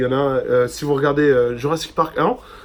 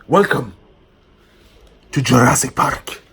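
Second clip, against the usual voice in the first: grand welcome; 5 dB louder than usual. Acted. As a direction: welcome them like a park host. Reads welcome letter aloud.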